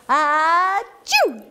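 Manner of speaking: high, drawn-out mock achoo; acted sneeze. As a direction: build up and burst into a pretend sneeze. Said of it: "what did he just do?".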